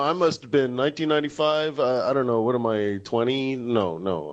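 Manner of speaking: ordinary talk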